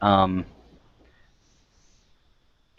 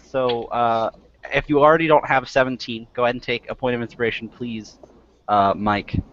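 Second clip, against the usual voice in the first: ordinary talk, nothing marked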